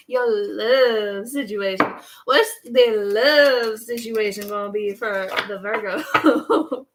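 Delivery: slow, playful drawl stretching LOVE; hammed-up flirty question. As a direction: tease it out, laughter bubbling up. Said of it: "Virgos could talk like that".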